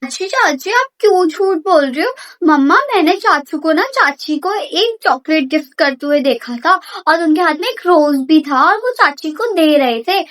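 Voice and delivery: put-on child blurting out loudly; loud excited tattle; loud tattling. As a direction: shout it out to catch her lie.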